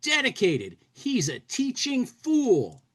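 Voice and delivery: high, slow cartoon voice gushing about the teacher; goofy gushing student. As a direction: rave about him in a high silly voice. Reading comic student gushing.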